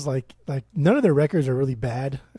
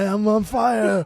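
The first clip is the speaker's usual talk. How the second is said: slow, higher mock panic; panicked Ozzy; 5 semitones higher, much slower than usual. Ozzy burning his tongue on a tablet, slow panicked wail.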